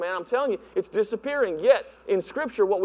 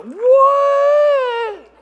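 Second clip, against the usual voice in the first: high, loud shocked cry of disbelief; shocked shout; 9 dB louder than usual. Retold or imitated. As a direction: blurt it out in total shock. Shocked reaction to honoring.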